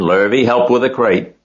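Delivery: loud hurried orders; urgent shout. A narrator's reading. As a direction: call out the order in a rush. Farmer barking orders in a rush.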